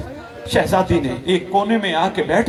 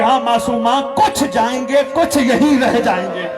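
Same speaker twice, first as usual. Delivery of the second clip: tearful reply; 6 semitones higher than usual. Acted. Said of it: "शहजादी रो के कहती है".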